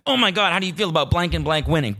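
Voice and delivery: high, rapid-fire mock message; mock gushing texter. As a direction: imitate someone gushing in a rush. Mimics girl typing the spoiler.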